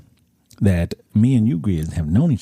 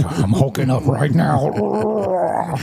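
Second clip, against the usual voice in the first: mock hulking up; 5 semitones higher, much slower than usual. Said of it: "I was like".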